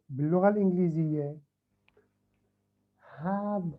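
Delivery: ordinary delivery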